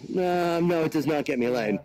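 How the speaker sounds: ordinary talk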